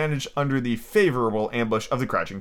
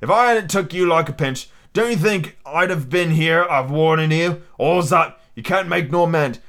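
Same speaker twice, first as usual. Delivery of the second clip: higher, pressing pirate voice; pirate pleading earnestly; 4 semitones higher, 3 dB louder than usual. Acted. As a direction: voice an old sea rogue urging his mate.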